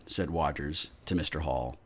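ordinary delivery

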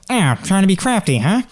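high, mocking taunt; lightly voiced sly NPC. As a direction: tease him for trying a trick. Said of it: "and goes".

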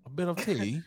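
British accent. Shown as a demonstration. Helping co-host get into it, British accent. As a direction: chime in with mock British. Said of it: "give me your British accent".

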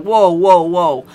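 loud, high whoa whoa whoa; retold loud whoa to calm an angry girlfriend; alarmed calming. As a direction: rush to stop her, loud and high. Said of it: "and I'm like".

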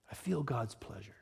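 low, breath-soft near whisper; quiet, reverent echo of a quote; hushed repeat. Acted. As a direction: let it sink in, almost whispered. Repeats film quote in hush.

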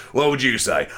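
ordinary talk, nothing marked

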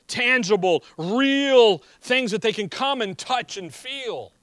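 loud, punched key words in a forceful sermon; emphatic preaching. Acted. Preacher urging believers to proclaim the gospel, emphatic preaching. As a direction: preach it out with rising force and hard stresses.

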